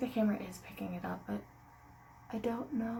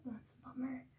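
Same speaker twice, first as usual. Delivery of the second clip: soft early-morning whisper; 5 dB quieter than usual. Real, not acted. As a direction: whisper so you don't wake anyone. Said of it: "I have to be a little quiet".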